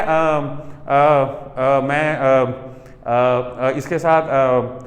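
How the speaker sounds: long drawn hesitant uhs; filler hesitation. Shown as a demonstration. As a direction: stall on fillers like a lost speaker. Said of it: "ये होता है pause".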